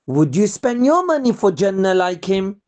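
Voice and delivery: plain reading voice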